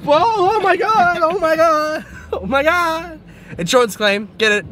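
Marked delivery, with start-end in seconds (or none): 0.0-3.2 high, squealing alarm; shocked shriek. Real, not acted. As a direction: squeal in shock at the near miss. Watching driving mishap, shrieking.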